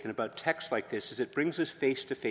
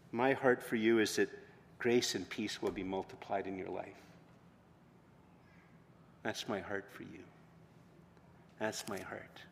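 very soft, lowered voice with long pauses; tender reassurance; 2 semitones lower, 5 dB quieter than usual. Acted. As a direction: speak gently as God reassuring a loved one. God's tender words, voiced by preacher.

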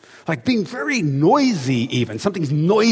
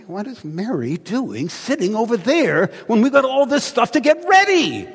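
higher, strained voice rising to a cry; exasperated complaint; 8 semitones higher than usual. Retold or imitated. Martha complaining to Jesus about Mary, exasperated. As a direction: fume about the work left to do. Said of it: "and she goes".

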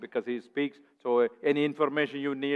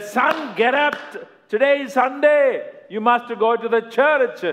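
much higher, loud scolding mother voice; mother's raised, high nagging call; insistent mother; 12 semitones higher, 8 dB louder than usual. Acted. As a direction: rouse the snoring son firmly. Mother scolding son, second time.

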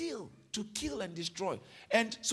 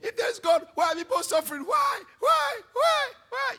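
loud, higher, anguished mock wail of why; upset crying out; 7 semitones higher, 8 dB louder than usual. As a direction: wail at God like a hurt complainer. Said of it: "Some people get upset".

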